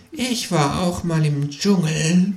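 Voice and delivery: high, slow, dreamy kangaroo voice; entranced murmur. A narrator's reading. Kangaroo remembering the jungle, entranced high murmur. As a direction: drift away as you murmur it. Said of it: "murmelt das Känguru entrückt".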